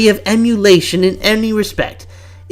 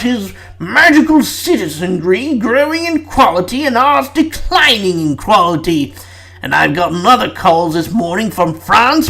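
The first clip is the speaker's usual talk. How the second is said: lightly voiced British Prime Minister, brisk; a hint of a posh British leader scolding; British Prime Minister accent. Prime Minister scolding Fudge on a call, mild British accent. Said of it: "how many British accents am I gonna do".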